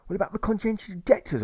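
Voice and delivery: a scandalised gossip; money changer gossiping. A narrator's reading. Money changer telling Alfred the news, high and scandalised. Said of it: "the money changer replies".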